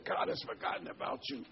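high whisper of the devil. Retold or imitated. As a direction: breathe it like a sly tempter. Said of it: "And the devil says".

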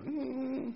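high mock woozy "mm"; drunken snake hum. Imitates snake dosed with whiskey.

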